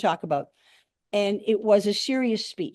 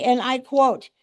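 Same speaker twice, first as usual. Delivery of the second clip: ordinary talk, nothing marked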